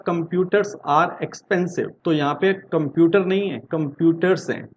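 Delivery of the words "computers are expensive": slow example read. Shown as a demonstration. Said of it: "जैसे कि हमने कहा".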